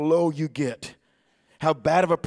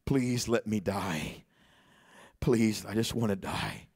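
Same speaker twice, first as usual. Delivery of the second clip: slow, softer, broken retold plea; imitating a man begging to die; despairing plea; 5 semitones lower, 7 dB quieter, slower than usual. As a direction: let the grief drag each word. Retelling grieving man's plea.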